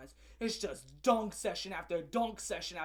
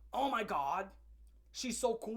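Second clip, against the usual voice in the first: ordinary delivery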